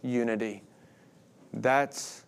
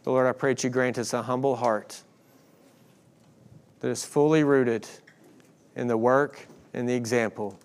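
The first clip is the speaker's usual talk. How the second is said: prayer; 2 semitones lower than usual. Real, not acted. Leads closing prayer.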